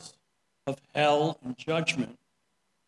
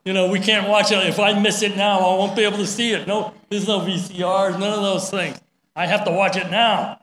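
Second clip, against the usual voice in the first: raised, insistent protest, louder and higher; desperate viewers refusing to leave, voice raised; the family protesting; 4 semitones higher, 5 dB louder than usual. Retold or imitated. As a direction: plead loudly that you can't miss the show. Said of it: "the family says".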